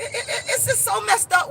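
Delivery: normal talking voice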